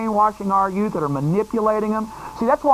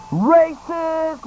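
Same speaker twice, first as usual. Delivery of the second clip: higher mock heckler voice; mock protester yell; 8 semitones higher than usual. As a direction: parody the shouting heckler. Mocking protesters at the rally.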